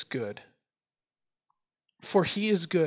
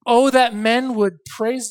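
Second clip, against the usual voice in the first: loud, high, slow psalm proclamation; declaimed scripture reading; 6 semitones higher, 9 dB louder, slower than usual. Acted. Preacher reading a psalm of thanks to the congregation, declaimed. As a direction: ring it out like a call to praise. Reads psalm aloud with force.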